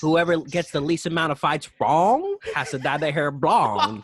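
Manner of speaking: loud, high, excited announcer; hyped announcer voice. Shown as a demonstration. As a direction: hype it up like a ring announcer. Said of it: "try to brand".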